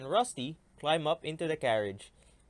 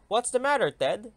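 raised, louder call across the farm; 7 semitones higher, 5 dB louder than usual. Acted. Farmer calls across the field to Ted, raised and loud. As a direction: call out to get his attention.

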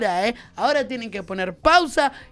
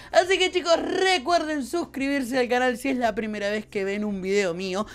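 ordinary delivery

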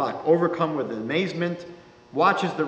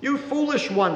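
high reproachful scold as the peasant; 11 semitones higher than usual. A narrator's reading. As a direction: chide her, kindly but firmly. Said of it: "says the rabbi reproachfully".

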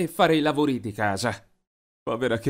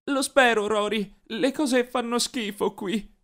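higher, slow, fighting back tears; a sister swallowing her tears, voice held tight; holding back tears; 8 semitones higher, slower than usual. A narrator's reading. Katie tells her brother things are awful, holding back tears. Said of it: "Lei trattenne le lacrime e disse".

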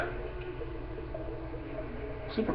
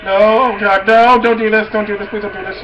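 panicked pleading; 5 semitones higher, 7 dB louder than usual. Real, not acted. Panicking at game attack.

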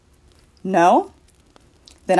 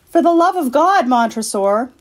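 high cry on God, then dropping; desperate plea; 6 semitones higher, 3 dB louder than usual. Acted. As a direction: beg for your life. Walled-in Fortunato begs.

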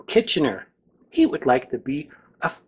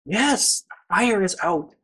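plain reading voice

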